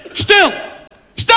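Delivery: rising to a high, loud "stil, stil!"; hushing shout. Retold or imitated. Father hushing the family as the call connects, urgent loud shout. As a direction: silence the whole family urgently.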